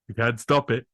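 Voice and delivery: usual speaking voice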